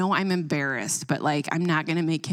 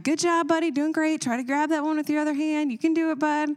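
high, bright cheering up to the wall; cheering mom; 10 semitones higher than usual. Retold or imitated. Mom calling up to her son on the wall, high cheering. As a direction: cheer him on from the bottom.

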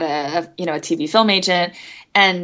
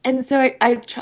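ordinary talk

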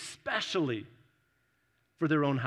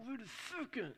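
high, put-upon voice of a startled listener; mock indignant churchgoer; 5 semitones higher, 5 dB quieter than usual. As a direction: play the protesting tither, pitched up. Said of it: "and you're just like".

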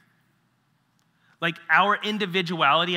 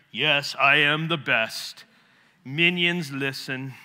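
slow, grand mock-royal proclamation; pompous Monty Python lord; 5 dB louder, slower than usual. Pastor mocking a preacher who thinks he's the best, grand mock decree. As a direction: ham it up like a self-important king. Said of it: "some Monty Python kinda thing".

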